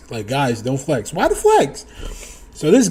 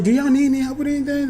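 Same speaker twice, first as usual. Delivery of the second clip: eager offer; 11 semitones higher, much faster than usual. Retold or imitated.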